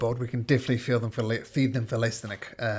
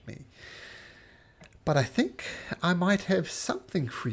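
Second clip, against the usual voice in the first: lightly voiced high, sly stranger; a hint of a secretive wanderer; mysterious stranger; 8 semitones higher, 4 dB louder, slower than usual.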